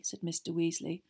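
usual speaking voice

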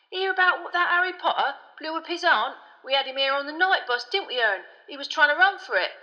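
high, loud, gleeful gossip; Stan the gossiping conductor. A narrator's reading. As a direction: spread scandal as the excitable conductor.

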